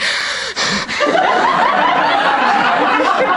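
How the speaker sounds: high helpless laugh; burst of laughter. Real, not acted. Cop looks at her.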